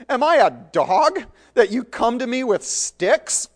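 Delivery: high, loud indignant sneer; giant's taunt. Acted. Goliath taunts David, loud and scornful. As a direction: roar out the insult.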